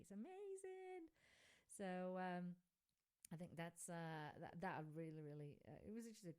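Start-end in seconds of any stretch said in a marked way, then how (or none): 0.0-1.1 very high, drawn-out marvelling; gushing wonder. Real, not acted. Host urging listeners to see the elephants, high gushing wonder. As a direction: sing it out slowly, way up high.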